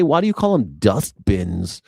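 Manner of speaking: ordinary delivery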